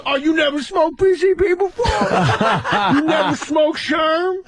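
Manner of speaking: high and incredulous, drag the words; Tracy impression. As Tracy asking if he never smoked PCP, high and amazed. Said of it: "And he goes".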